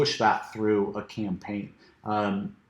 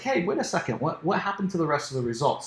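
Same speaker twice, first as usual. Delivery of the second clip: surprised; 4 semitones higher, faster than usual. Retold or imitated. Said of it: "I was like".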